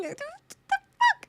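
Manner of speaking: sudden high squeal of disbelief on "fuck"; shocked outburst. Retold or imitated. Staring at herself in the mirror, high shocked squeal. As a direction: stare in the mirror and shriek in disbelief. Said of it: "and you're going".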